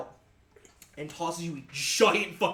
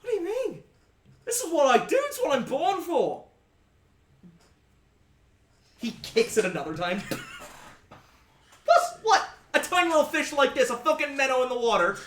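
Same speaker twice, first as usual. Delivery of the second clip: much higher, louder swaggering sailor voice with a laugh; cocky sea captain; 10 semitones higher, 6 dB louder than usual. Acted. Captain scoffing at the party's fear, high loud swagger.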